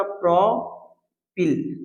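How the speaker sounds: slow, stretched propyl with a falling end; drawn-out teaching word. Shown as a demonstration. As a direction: draw propyl out slowly for the class. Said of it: "तो क्या हो जाएगा?".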